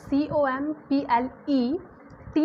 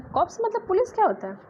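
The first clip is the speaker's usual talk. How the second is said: much higher, puzzled question; mock surprised student; 8 semitones higher than usual. Teacher voicing a puzzled student, high and surprised. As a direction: play the doubtful student asking. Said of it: "अब आप बोलें".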